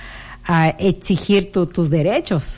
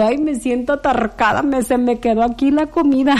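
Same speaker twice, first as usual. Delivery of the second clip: high whiny mock complaint; lightly hammed-up overfed moan; complaining to a friend; 6 semitones higher than usual. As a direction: whine about the food stuck in your chest. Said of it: "Le digo".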